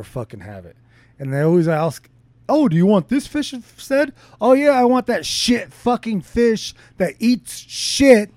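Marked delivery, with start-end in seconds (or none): 2.5-4.1 high, bright-eyed mock server offer; mocking the restaurant worker offering other fish; mock waiter. Mimicking restaurant staff offer. 4.4-8.3 high, slow, dripping sarcasm; mock-agree slowly, pitched up and sneering; sarcastically refusing farm-raised fish; sarcastic reply.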